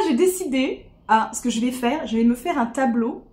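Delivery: ordinary talk, nothing marked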